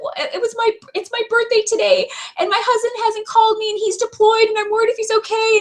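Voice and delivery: tearful explaining. Retold or imitated. Client explains her deployed husband hasn't called, teary high voice. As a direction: pour it out, worried and near tears.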